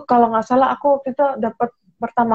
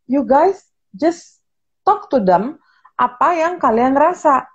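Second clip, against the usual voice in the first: slow, deliberate advice; 3 semitones higher, slower than usual. Retold or imitated. Retelling her advice to people, said slowly and deliberately. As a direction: spell it out slowly to the audience. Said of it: "Aku bilang".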